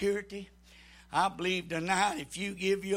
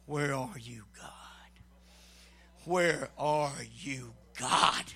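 breathy whisper, lower, slow; whispered plea; 6 semitones lower, 4 dB quieter, slower than usual. Retold or imitated. A desperate believer whispering to God, hushed plea. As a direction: whisper it like a lost prayer. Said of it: "you're saying".

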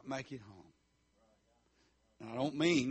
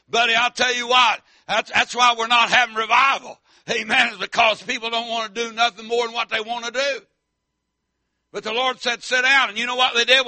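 loud, higher shouted preaching; full-force preaching; 6 semitones higher, 15 dB louder than usual. Acted. Preacher shouting faith to the congregation, loud and high.

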